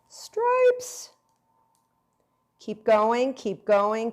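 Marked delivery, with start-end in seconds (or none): 0.1-1.1 squeaky high stripes. Pretend. Making chocolate stripes.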